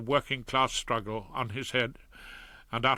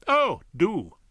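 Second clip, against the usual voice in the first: dry, higher cynical jab; mock his bluster with a louder, higher retort; 8 semitones higher, 6 dB louder than usual. A narrator's reading. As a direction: cynical wife dismissing her husband's bluster. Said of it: "Lady Featherstone was cynical".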